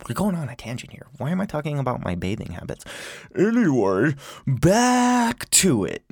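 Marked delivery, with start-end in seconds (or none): 3.4-6.0 high, stretched-out singsong; loud, drawn-out silly transition; goofy segue. Real, not acted. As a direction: swing back on topic with comic flourish. Exaggerated return from tangent.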